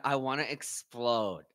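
drawn-out, softly strained mimicry; imitated holding back. Streamer mimics the fighter trying to keep emotions down, restrained. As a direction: imitate someone keeping a lid on it, slowly. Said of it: "he literally said it like that".

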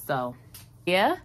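ordinary delivery

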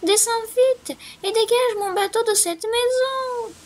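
high, eager mouse voice; little mouse voice. A narrator's reading. Mouse begging the wind for help, high and squeaky. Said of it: "s'écria le souriceau".

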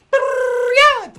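squealed high and loud; high excited shout. Acted. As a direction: throw out a high, loud yell. Song break shout.

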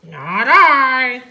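loud, drawn-out pig voice; storybook pig. A narrator's reading. Pig refuses to help harvest, loud character voice. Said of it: "said the pig".

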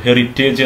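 ഹെറിറ്റേജ് as "heri-TAYJ"; long "-ayj" ending on ഹെറിറ്റേജ്; common mispronunciation. Shown as a demonstration. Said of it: "എന്നാണ് പറയാറുള്ളത്".

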